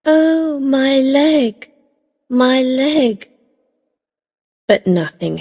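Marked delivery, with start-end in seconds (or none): 0.0-3.3 higher, lightly faked cry of pain; a hint of a hammed-up injured woman; mock cry of pain. Dorothy imitating Rosa faking an accident, mock cry of pain. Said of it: "she's going to cry out".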